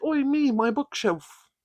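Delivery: dad in a hammed-up British accent; Cockney dad moaning over "my bookshelf", pitched high. Shown as a demonstration. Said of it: "Okay, accents".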